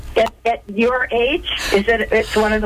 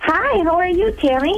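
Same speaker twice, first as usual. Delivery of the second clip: a smile you can hear, higher; smiling voice; 8 semitones higher than usual. Real, not acted. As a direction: greet her with a smile. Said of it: "you can hear you smile when you say hi".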